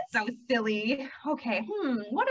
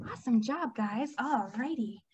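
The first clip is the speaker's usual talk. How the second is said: ordinary delivery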